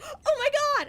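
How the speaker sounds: high, loud excited cry; near yell. Retold or imitated. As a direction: yell it like a bouncing overexcited dad. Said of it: "almost yelling it".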